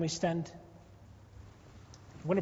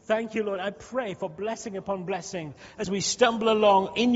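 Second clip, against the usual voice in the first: fervent intercession; 4 dB louder than usual. Acted. Pastor interceding for the church and nation, fervent then hushed. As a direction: pray boldly, then settle into reverence.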